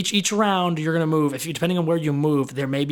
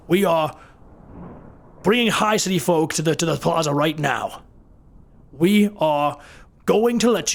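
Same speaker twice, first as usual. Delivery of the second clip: guard commanding; 3 semitones higher than usual. Acted. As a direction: bark the order to halt at the fleeing crowd. GM voices guard halting crowd.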